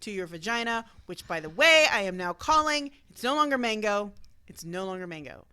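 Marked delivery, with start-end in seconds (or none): 4.5-5.5 soft mock tender. Renames vagina in soft voice.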